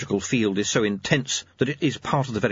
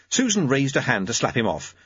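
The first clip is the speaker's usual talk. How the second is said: ordinary delivery, nothing marked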